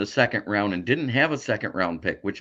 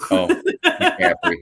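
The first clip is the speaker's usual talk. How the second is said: laughing realization; 5 dB louder, slower than usual. Real, not acted. Laughs at the missed player.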